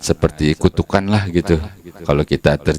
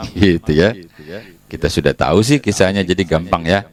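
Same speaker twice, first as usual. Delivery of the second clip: ordinary talk, nothing marked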